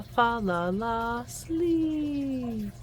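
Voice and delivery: usual speaking voice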